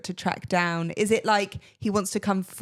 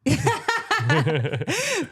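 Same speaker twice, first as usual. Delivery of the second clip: hearty laugh; 7 semitones higher than usual. Real, not acted. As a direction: burst of higher-pitched laughter. Laughing at the accent attempts.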